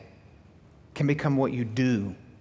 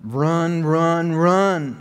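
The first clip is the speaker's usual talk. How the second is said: urgent louder call; 5 semitones higher, 6 dB louder, much slower than usual. Real, not acted.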